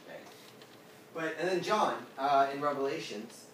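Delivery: normal talking voice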